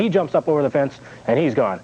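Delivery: ordinary delivery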